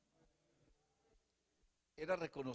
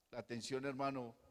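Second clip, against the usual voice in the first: normal talking voice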